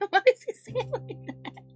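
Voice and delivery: high and loud through laughter; real incredulous laughing squeal; laughing disbelief. Real, not acted. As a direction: shriek it out, cracking up. Cracking up at the clip.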